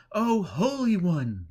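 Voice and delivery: high, slow, overjoyed cry of thanks; joyful pilgrim. A narrator's reading. Pilgrim greeting the holy man with slow joyful cry. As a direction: pour out joy to the holy man.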